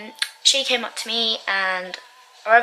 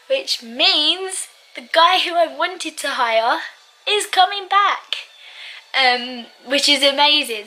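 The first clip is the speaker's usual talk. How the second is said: much higher, slow and bright with joy; can't hide the excitement; excited; 5 semitones higher, 3 dB louder, slower than usual. Real, not acted. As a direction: gush about the good news. Thrilled guy is coming back.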